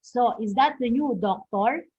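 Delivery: "is that the new doctor" with a lift at the end; rising intonation. Shown as a demonstration. Teacher repeating the example question, rising on "doctor". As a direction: raise the voice on "doctor". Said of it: "the sentence is going up".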